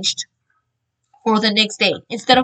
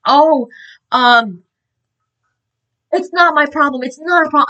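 retold scream; 2 semitones higher, 4 dB louder than usual. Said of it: "she starts screaming at me".

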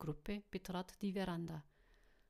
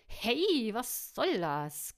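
loud, high indignant squeal; 4 semitones higher, 9 dB louder, much slower than usual. A narrator's reading. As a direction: squeal in protest, stretching the words out. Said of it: "quiekste sie".

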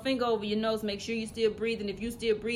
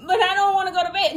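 put-on fast, higher whining child; whiny kid protest; 7 semitones higher, 6 dB louder, faster than usual. Child refusing bedtime to his dad, fast whine.

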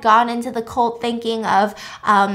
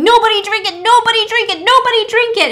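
high, loud frantic scream; panicked shrieking warning; 11 semitones higher, 9 dB louder than usual. Retold or imitated. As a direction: scream the warning over everyone. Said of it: "starts screaming".

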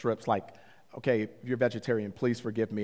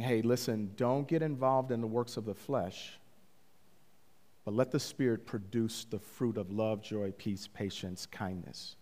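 soft, calm instruction, fading quieter; gentle Holy Spirit counsel; 3 semitones lower, 7 dB quieter than usual. Retold or imitated. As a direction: counsel tenderly, growing lower and softer. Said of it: "the Holy Spirit is saying".

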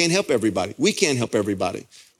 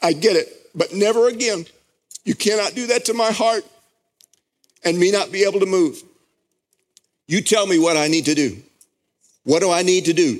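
high, ringing sermon voice at full force; fervent pulpit delivery; impassioned preaching; 6 semitones higher than usual. Acted. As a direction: preach it, swept up in the moment. Passionate vow to God.

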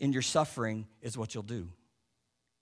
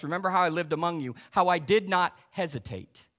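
preaching Paul's words; 3 semitones higher than usual. Acted. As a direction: proclaim it as Paul to the church. Preacher voicing Paul's letter.

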